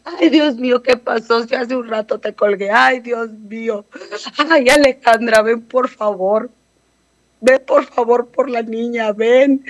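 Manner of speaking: imitating Estrellita's desperate wail; retold frantic cry. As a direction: replay the aunt's frantic pleading, half-acted. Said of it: "grita la Estrellita".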